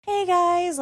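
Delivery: high, upbeat service-desk greeting; customer service voice. Shown as a demonstration. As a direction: greet them like a friendly shop clerk. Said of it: "my customer service voice".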